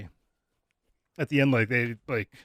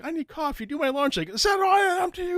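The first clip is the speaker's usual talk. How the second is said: very high-pitched mock nagging; imitated demanding women. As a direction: mimic their demands in a squeaky-high voice. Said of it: "they're all like".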